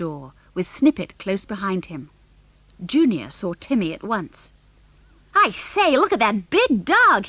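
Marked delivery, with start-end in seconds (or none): 5.3-7.3 put-on high, loud, eager kid; excited American boy. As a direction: squeal over the big dog like a brash boy. Junior excited at seeing dog.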